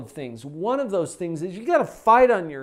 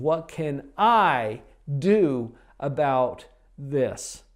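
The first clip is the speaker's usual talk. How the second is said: slow pondering question; much slower than usual. Shown as a demonstration. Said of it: "get back to asking".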